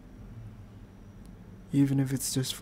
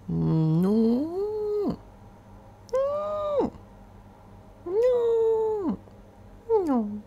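whiny no; much slower than usual. Real, not acted. Playful whining refusal.

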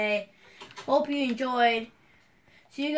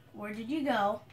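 ordinary delivery

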